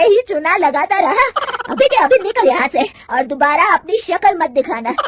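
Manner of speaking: mother-in-law driving out the thief, loud and furious. Acted. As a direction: throw him out in a rage.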